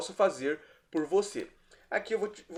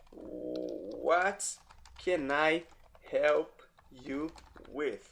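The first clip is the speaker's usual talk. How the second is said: slow and drawn out, word by word; "What can I help you with?" stretched slowly, each word set apart; slow teaching demo; 3 semitones lower, 7 dB quieter, much slower than usual. Shown as a demonstration. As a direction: spell it out slowly for a learner. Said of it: "teacher repeats the phrase for learners".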